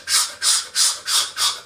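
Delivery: whispered, hissing shh sounds; imitated shushing. Mimicking the colleague who shushes her, whispered shh.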